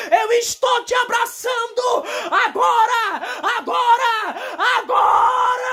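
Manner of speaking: God's voice booming. Retold or imitated. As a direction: proclaim like God, swelling at each agora. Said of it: "a voz de Deus bradou".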